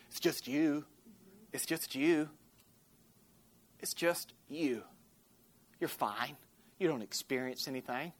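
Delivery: a hint of a sly liar soothing you; lightly voiced tempter. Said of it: "The enemy will lie to you and say".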